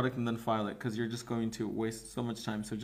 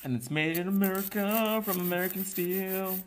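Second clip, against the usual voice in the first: high, sustained mock-patriotic boast; playful proud proclamation; 8 semitones higher than usual. Host boasting about the nail file, playful proclamation. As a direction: proclaim it high with held vowels.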